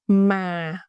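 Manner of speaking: "ma" drawn out, pitch gliding down; "ma" in the falling huyền tone. Shown as a demonstration. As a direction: let "ma" sink gently in pitch. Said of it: "is a falling tone".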